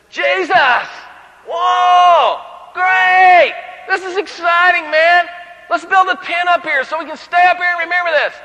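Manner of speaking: high, loud, gushing cry of delight; booming, giddy enthusiasm; excited shout. Acted. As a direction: blurt it out like an overeager fan. Peter gushing on the mountain.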